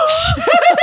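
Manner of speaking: high, loud laugh; burst of laughter. Real, not acted. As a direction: explode laughing. Laughing at roast beef sandwich.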